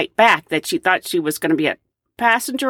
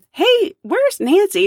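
eager call; 11 semitones higher, slower than usual. Retold or imitated. Passengers looking for her.

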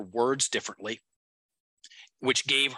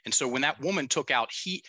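ordinary delivery

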